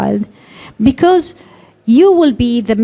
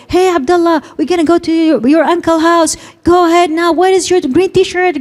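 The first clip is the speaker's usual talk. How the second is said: higher, wordy, overexplaining mom voice; higher, busy mom voice telling the child about the trip; chatty mom rambling at child; 6 semitones higher than usual. Shown as a demonstration. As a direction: play the mom who talks too much before getting to the point. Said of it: "Please don't tell the child".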